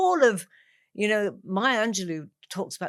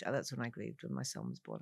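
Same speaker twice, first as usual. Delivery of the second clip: softer, sinking repeat of grieved; quiet; 9 semitones lower, 12 dB quieter than usual. Real, not acted. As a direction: let it drop to a hush.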